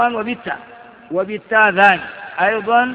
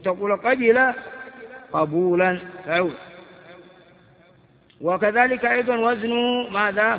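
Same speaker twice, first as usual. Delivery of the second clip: ordinary talk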